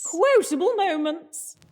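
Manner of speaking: slow, high segment jingle voice; announcer voice. Acted. Host announcing the segment in a high, slow jingle voice. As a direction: sing out the segment title grandly. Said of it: "I created a sodding effects for that".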